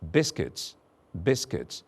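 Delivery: "Biscuits" with two short i vowels; word drill. Shown as a demonstration. Teacher drilling the word biscuits. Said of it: "It's an I in bis".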